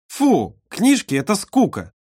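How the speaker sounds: put-on high, whiny little girl; disgusted little girl. A narrator's reading. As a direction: scoff like a bored child. Olka scornful about books.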